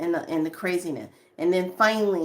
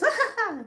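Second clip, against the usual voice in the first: high-pitched put-on giggle; mock high ha-ha-ha; mimicked laugh. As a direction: imitate another woman's tittering laugh. Imitates the woman's laugh.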